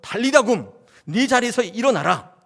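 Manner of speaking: loud, high call to rise; commanding shout. Acted. Jesus calling the dead girl to rise, commanding shout. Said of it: "외치셨던".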